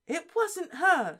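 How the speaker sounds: slow, nervous but determined. A narrator's reading. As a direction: speak up nervously. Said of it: "looked very nervous".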